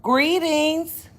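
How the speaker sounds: high, loud drawn-out greeting; sing-song greeting. Acted. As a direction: call it out to the viewers. Vlog greeting, loud high call.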